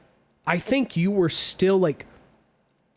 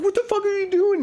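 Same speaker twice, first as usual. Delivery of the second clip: very high, fast imitation of alarm; mock panicked outburst; faster than usual. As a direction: squeal it like an alarmed passenger. Retold panic at speeding driver.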